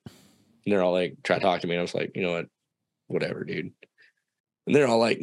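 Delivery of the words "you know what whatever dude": soft, low-energy brush-off; dismissive. Retold or imitated. Angry teen to friends trying to talk, dismissive. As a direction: shut the chat down quietly. Pissed off, avoiding conversation.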